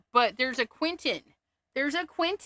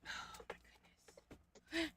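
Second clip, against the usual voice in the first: breathless whispered oh my goodness; whispered dismay at the mess; 4 semitones higher, 21 dB quieter, faster than usual. Real, not acted. Overwhelmed by mess.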